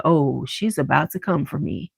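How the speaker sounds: imitating a boy backing down under his breath; subdued aside, imitated. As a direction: drop it low and soft, as a retold realization. Student's cowed retort, retold.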